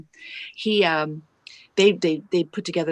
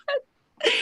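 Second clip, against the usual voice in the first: high, startled cry of disbelief; shocked squeak. Retold or imitated. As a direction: yelp it like you can't believe your ears. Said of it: "I said".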